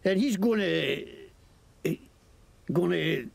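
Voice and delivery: lightly Scottish mocking thug; Scottish accent. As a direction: sneer at them in Scots. Scottish NPC bargains.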